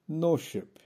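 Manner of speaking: ordinary talk, nothing marked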